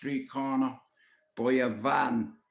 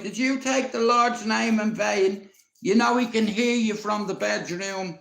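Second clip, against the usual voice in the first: put-on higher, louder scolding old granny; 7 semitones higher, 5 dB louder than usual. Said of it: "She's like".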